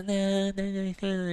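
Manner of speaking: high, light repeated no; mock refusal. Husband imitating his wife pretending to refuse a drink. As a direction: fake a dainty refusal.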